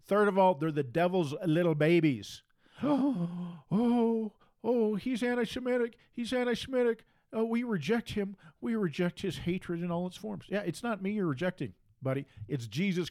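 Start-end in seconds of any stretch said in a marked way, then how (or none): 2.8-4.3 a theatrical gasp of fake shock; mock gasp. Speaker mocks imagined critics with a fake gasp. Mocking an imagined outraged reaction. 4.6-9.8 higher, prissy mock-shocked voice; mock outrage. Speaker mocks imagined critics in a higher, mock-shocked voice.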